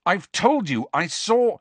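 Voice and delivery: high, heated retort; impatient, high-pitched protest; exasperated. A narrator's reading. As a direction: insist you have told them already.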